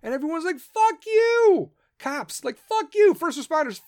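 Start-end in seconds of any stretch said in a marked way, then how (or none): 0.6-1.7 much higher, loud mock yell; defiant yell. Everyone rejecting military's return. 2.6-3.1 much higher, loud mock yell; defiant yell. Cops rejecting military's return.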